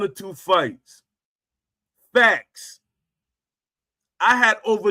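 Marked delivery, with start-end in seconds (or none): 2.1-2.8 loud, clipped declaration; emphatic punchline. Retold or imitated. Hammering each boast with a loud mock "Facts". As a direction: slam the word down. Punctuating each boxing claim.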